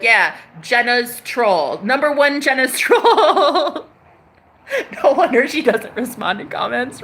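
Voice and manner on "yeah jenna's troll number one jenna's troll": slow, laughing, drawn-out boast; mock proud troll. Mocking a troll who shows off his badge. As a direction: laugh through a troll's bragging title. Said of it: "would legit put it right here and be like".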